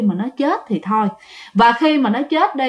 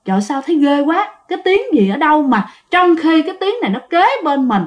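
plain reading voice

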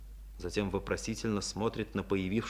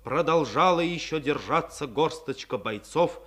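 narration lifted higher and louder with solemn force; rising dramatic narration; 4 semitones higher, 6 dB louder than usual. A narrator's reading. Narrator heralding the defenders still holding out, raised solemn voice. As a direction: announce the defenders' last stand with swelling pride.

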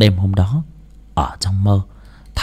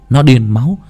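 plain reading voice

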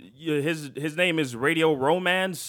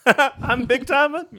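high, slow laughing squeal; laughing mock question; 10 semitones higher, 8 dB louder, slower than usual. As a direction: laugh it out incredulously. Mocking quote, laughing.

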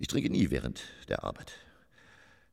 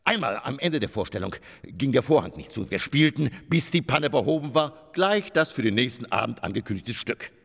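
grandiose storytelling; 9 semitones higher, 6 dB louder than usual. Acted. Grand anecdote, loud.

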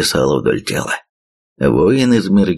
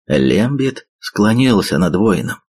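ordinary delivery

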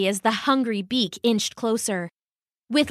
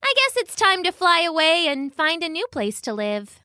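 higher-pitched bird character voice; resigned magpie; 7 semitones higher than usual. Acted. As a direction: voice a magpie giving up and leaving. Magpie's line, voiced above narration.

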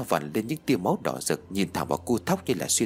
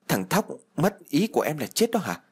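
shocked disbelief; 6 semitones higher than usual. Acted. Shocked at news of death.

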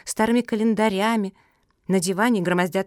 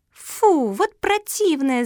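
put-on high, loud childish outburst; indignant cry; 9 semitones higher, 4 dB louder, much slower than usual. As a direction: cry out in high-pitched disgust. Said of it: "закричала Куки".

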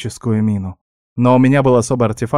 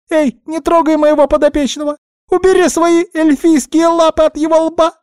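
very high, squeaky little-animal voice; wailing guinea pig; 4 dB louder than usual. A narrator's reading. Guinea pig lamenting to her friend, high squeaky wail. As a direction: squeal it out in a distraught flood. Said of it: "свинка заголосила".

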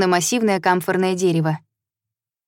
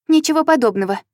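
snapping; 8 semitones higher than usual. A narrator's reading. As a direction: snap back at him. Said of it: "огрызнулась Хайя".

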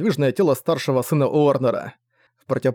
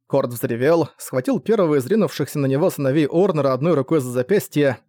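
ordinary delivery, nothing marked

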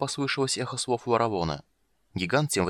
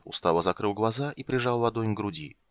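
ordinary delivery, nothing marked

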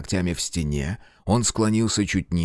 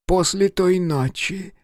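high, slow, eager monster voice; drawn-out and hopeful, high-pitched; eager creature voice; 8 semitones higher, slower than usual. Acted. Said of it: "снова сказал грех".

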